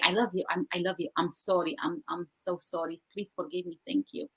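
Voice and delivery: very soft and low, retold self-talk; hushed repetition. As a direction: murmur it barely audibly, as if to yourself. Re-enacts repeating phrases despite resistance.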